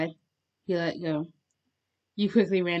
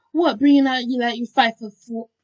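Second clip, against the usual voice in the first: raised, loud bickering voice; indignant retort; 5 semitones higher, 8 dB louder than usual. A narrator's reading. Held listener bickering with Bakugo, indignant. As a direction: throw it back loudly, pitched up.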